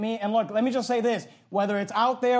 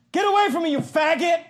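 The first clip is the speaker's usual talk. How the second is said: higher, louder mock yell; re-enacting yelling at a man in a parking lot, higher and louder; retold yelling; 8 semitones higher, 5 dB louder than usual. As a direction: bark it out at the stranger. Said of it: "like, yelling".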